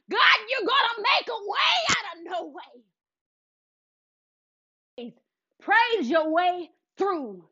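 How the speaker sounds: rising, shouted prayer with a cry in the voice; fervent prayer. Acted. Speaker praying aloud to God, crying and shouting praise. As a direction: pour it out to God, shouting through tears.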